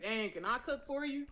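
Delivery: ordinary talk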